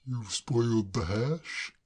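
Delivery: a hint of a puzzled gentleman; mildly baffled; lightly voiced Braddock. Braddock puzzled by Claire, mildly baffled.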